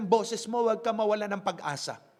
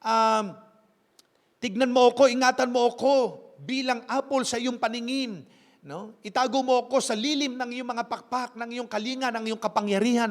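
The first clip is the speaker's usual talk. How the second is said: louder, pleading prayer voice; paraphrased prayer; 4 dB louder than usual. Acted. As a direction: cry out to the Lord for protection. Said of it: "Yan ang prayer ni David".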